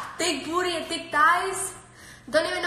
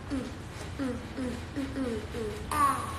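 normal talking voice

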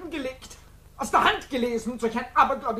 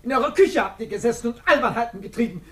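ordinary delivery

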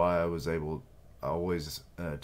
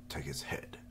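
soft, lower whispered command; whispered order; 3 semitones lower, 9 dB quieter than usual. Acted. Soldier quietly ordering the kill, whispered.

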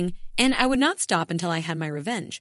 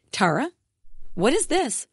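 stammering in shock; 7 semitones higher than usual. A narrator's reading. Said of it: "Samantha stammered".

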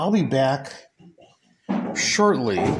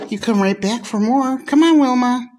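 high coaxing; 11 semitones higher, 4 dB louder than usual. Real, not acted. High coaxing call to goat.

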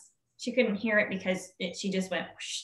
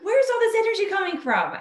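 much higher and louder amazed question; 12 semitones higher, 6 dB louder than usual. Retold or imitated. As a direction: ask it as if the energy is a mystery. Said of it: "And we were like".

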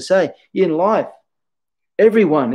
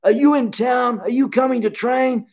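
much higher, beaming mock voice of a delighted old teacher; 11 semitones higher than usual. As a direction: greet a favourite student on the phone with joy. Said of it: "He goes".

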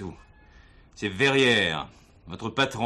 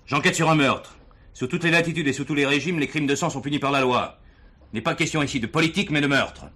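louder, quicker, sternly laying down the law; firm stern warning; 6 semitones higher, 4 dB louder than usual. Acted. Police commissioner silencing the mocking prisoner, stern and raised. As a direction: cut him off and take control.

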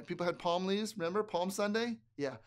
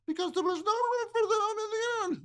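high, louder mermaid trill; 5 dB louder than usual. Acted. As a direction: belt it like a mermaid. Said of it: "A mermaid voice".

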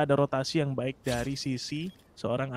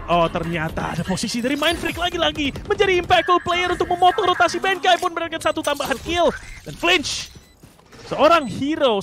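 rising hype, higher and louder; esports play-by-play; 6 semitones higher, 4 dB louder than usual. Acted. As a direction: call the action with building excitement. Hype esports casting rises.

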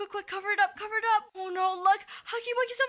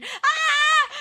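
loud high scream of alarm; panicked scream; 7 semitones higher, 7 dB louder than usual. Pretend. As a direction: scream as bombs land near you. Bombs dropped on hiding player.

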